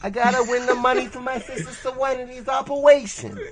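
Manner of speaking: put-on high, loud Brooklyn kid voice; Joey impression. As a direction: play it like an excitable anime hero. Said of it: "really good Joey impression".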